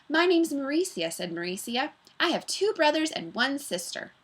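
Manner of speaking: put-on girl introduces herself, high and sweet; milkshake-sweet voice. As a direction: pour it out sweet and smooth. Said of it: "like a pineapple milkshake".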